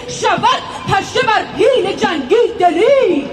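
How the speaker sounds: loud, rhythmic chant of epic verse; epic teahouse storyteller. Acted. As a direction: declaim the verses like a bard. (نقالی حماسی با صدای بلند)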